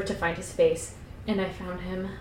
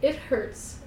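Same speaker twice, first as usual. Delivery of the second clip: soft, high whimper; small pained voice, pitched up; 5 semitones higher, 5 dB quieter than usual. A narrator's reading. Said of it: "I whimpered".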